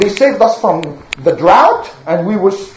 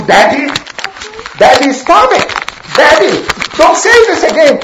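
whiny son; 4 semitones higher, faster than usual. Acted. Son begging his father to stop, rising childish whine. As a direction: nag your dad, embarrassed.

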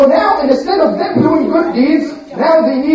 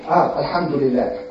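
low, soft grudging mumble; reluctant mumble; 10 semitones lower, 7 dB quieter than usual. Acted. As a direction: mumble it half-heartedly, lower and softer. Trickster says phrase reluctantly.

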